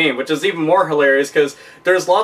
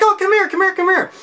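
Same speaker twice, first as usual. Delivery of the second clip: much higher, eager salesman voice; pushy Sega rep. Retold or imitated. Sega rep at the show floor calling you over, much higher pushy voice. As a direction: beckon passers-by like a hammed-up booth rep. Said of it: "you got a Sega rep".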